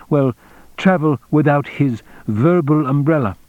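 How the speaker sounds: the general, explanatory. Acted. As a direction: lay out the argument as a precise general.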